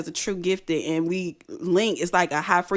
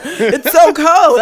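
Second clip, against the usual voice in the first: very slow, loud, laughing; laughing slow cry; 7 semitones higher, 10 dB louder, much slower than usual. Retold or imitated. [name] repeating it to friends, slow laughing cry. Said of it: "he was like".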